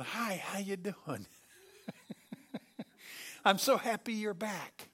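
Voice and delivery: happy dog voice. Pretend. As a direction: gush like a forgiving puppy. Dog greeting after scolding.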